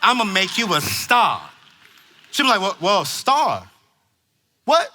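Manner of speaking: loud, high, driving sermon voice; full-force preaching. Acted. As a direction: preach it hard to the parents.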